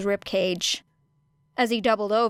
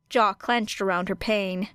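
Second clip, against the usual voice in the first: ordinary delivery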